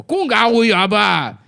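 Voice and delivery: high, slow, loud gibberish; fake Chinese. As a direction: bellow hammy fake Chinese. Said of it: "I speak fake Chinese".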